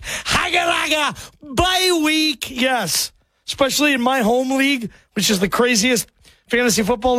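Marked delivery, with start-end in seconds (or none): 0.0-2.5 higher, gleeful taunt at the sweating rivals; gloating mock cheer. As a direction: crow about your bye week like a smug fantasy manager. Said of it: "and you're just like".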